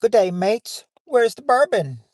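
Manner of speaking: high-pitched, put-on Kiwi greeting; attempted New Zealand accent. Host taking a second try on request, put-on New Zealand accent. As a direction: have a playful stab at a New Zealand accent. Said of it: "do a New Zealand accent".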